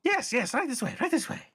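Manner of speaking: very high-pitched goblin host; high, eager goblin voice; goblin character, eager. Acted. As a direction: usher guests along as an excited goblin.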